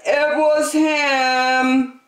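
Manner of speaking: high, slow, exaggerated blaming voice; sneaky accusation. Pig blaming Trevor for the stink, high and slow. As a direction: drag it out as a guilty pug pointing. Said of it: "pointed at Trevor and said".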